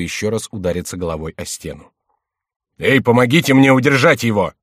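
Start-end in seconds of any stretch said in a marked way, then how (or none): 2.8-4.5 loud urgent call for help; shout for help. A narrator's reading. King shouting for help to restrain his favourite. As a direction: call the guards in alarm. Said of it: "закричал Генрих".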